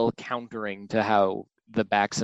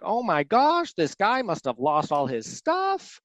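much higher, sweet, kindly creature voice; endearing Baxon voice; 8 semitones higher than usual. Retold or imitated. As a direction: play the gentle monster amazed by a find. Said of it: "he comes across all this stuff and he's like".

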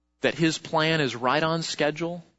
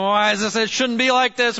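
mock frustration; 4 semitones higher, 4 dB louder than usual. Mock angry Facebook user.

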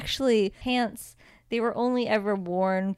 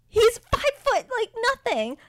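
much higher, stretched-out exasperated disbelief; incredulous rant; 13 semitones higher than usual. Real, not acted. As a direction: drag it out high like you can't believe it. Ranting about actor's height.

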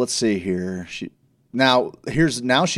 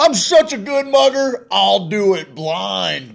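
much higher, louder, cocky voice; mock boastful mugger; 10 semitones higher, 6 dB louder, slower than usual. Host mocking a mugger bragging he works blind, much higher and louder. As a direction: brag like a show-off crook. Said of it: "You're like".

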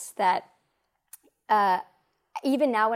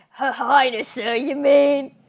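put-on drawn-out, louder grizzled old-man one-liner; 4 semitones higher, 6 dB louder, slower than usual. Host imitating the actor's old-man one-liner, grizzled and drawn out. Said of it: "Who is this grizzled guy?".

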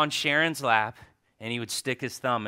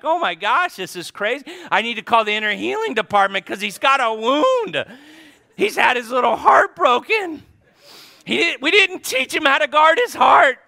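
much higher, louder hammed-up alarm; mock parental panic; 12 semitones higher, 9 dB louder than usual. Mom and dad panicking over their heartbroken son, mock-frantic and loud.

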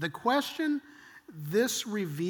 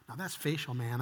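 normal talking voice

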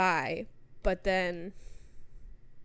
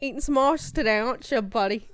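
hammed-up Brit; mock British accent; 2 semitones higher, 4 dB louder than usual. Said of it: "British bit continues".

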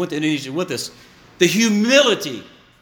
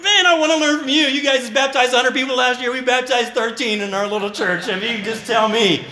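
eager admiration; 6 semitones higher than usual. Retold or imitated. Pastor eagerly begging Indonesian brothers to teach him. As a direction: beg them earnestly to teach you. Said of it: "and I'm like".

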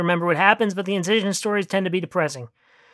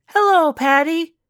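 higher, slower woman's voice; high slow female voice; 7 semitones higher, slower than usual. A narrator's reading. Elena greeting in bed.

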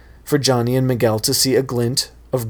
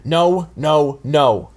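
mild shout; 4 semitones higher, much slower than usual. Acted. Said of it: "Johnny shouted".

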